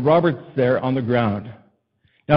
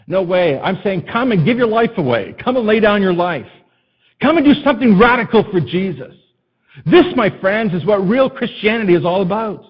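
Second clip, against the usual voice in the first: raised, higher-pitched rallying cry; fervent preaching; 7 semitones higher, 5 dB louder than usual. Real, not acted. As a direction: preach it with rising urgency to the congregation. Preaches at full force.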